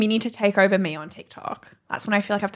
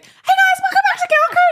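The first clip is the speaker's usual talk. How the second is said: much higher and louder, squeaky; chipmunk voice; 6 dB louder than usual. Retold or imitated. Cohost mocking her friend's chipmunk-sounding intro. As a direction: pitch it up like a chipmunk. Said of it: "Yours was, like, really up".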